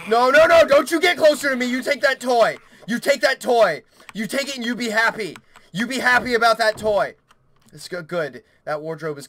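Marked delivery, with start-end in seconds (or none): 0.1-7.1 high, loud frantic scolding; panicked. Real, not acted. Player telling creature to take the toy, panicked. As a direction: order it back in a panic.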